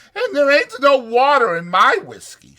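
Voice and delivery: proud madam boasting; Susie quoted. Retold or imitated. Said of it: "she says".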